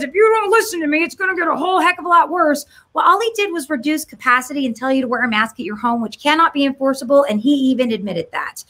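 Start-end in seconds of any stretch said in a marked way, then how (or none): 0.0-2.7 higher, half-shouted mock threat; mock stern governor. Governor warning the public, higher mock shout. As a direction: bark the warning like a pompous official. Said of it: "He comes out and says".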